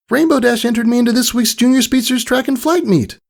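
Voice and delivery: high, bright-eyed filly voice; a proud young filly sharing big news; eager young pony girl; Scootaloo. Acted.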